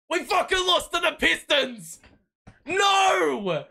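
high, loud disbelieving yell, laughing on the no; furious shout. Real, not acted. Fan screaming as his team loses to the Pistons. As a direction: bellow the loss out.

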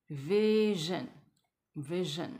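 slow, drawn-out "vision" with zh in the middle; teacher modelling "vision" slowly; syllable demo. Shown as a demonstration. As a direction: stretch "vision" as vi-zhun, very deliberately. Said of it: "后面是vision".

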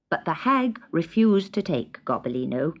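ordinary delivery

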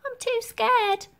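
put-on high, slow, timid child; lightly voiced frightened orphan; scared child's murmur. A narrator's reading. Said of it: "There were murmurs of".

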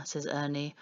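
plain reading voice

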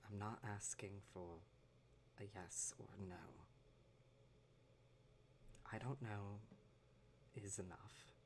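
low, soft, measured reassurance. Acted. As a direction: speak gently under your breath, leaving long pauses. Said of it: "speaking in a, a lower voice".

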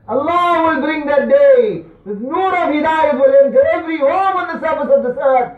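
high, loud rallying sermon cry; ringing preacher's promise, pitched high; impassioned preaching. Acted. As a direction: proclaim it with full conviction. Preaches at full force.

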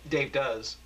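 plain reading voice

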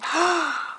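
quick, high gasp; delighted gasp. Real, not acted. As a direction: gasp in happy surprise. Sees the next gift item.

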